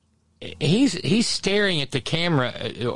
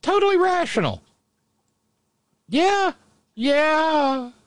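sarcastic; 3 dB louder than usual. Real, not acted. Mocking sarcastic agreement.

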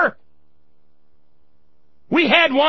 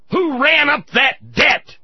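angry shout; 3 semitones higher than usual. Real, not acted. Host yelling at the veteran over the debt, angry. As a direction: demand an answer.

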